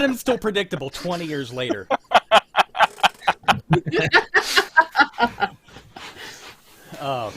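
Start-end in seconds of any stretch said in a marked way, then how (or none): 1.9-5.5 long loud burst of real laughter; big laugh. Real, not acted. Host bursting out laughing at his friend's joke. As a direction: crack up loudly at the joke.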